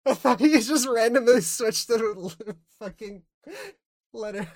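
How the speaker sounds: cracking up mid-sentence at a misheard letter; laughing. Real, not acted. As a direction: laugh your way through it.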